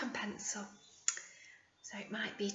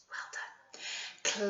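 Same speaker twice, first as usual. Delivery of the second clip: soft whispered praise; whispered praise to the children; 3 semitones lower, 10 dB quieter than usual. Acted. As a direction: whisper well done.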